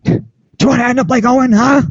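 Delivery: high, far-off mock yell of an angry boss; hammed-up boss yelling down the headset; angry Vince impression. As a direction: ham up the boss screaming into the headset. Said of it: "what Vince was screaming at him".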